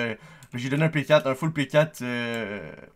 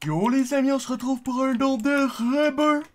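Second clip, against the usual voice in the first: hype announcer; 13 semitones higher, 6 dB louder than usual. Real, not acted. Announcing next donation.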